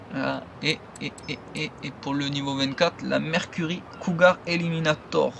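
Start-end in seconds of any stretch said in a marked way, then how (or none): none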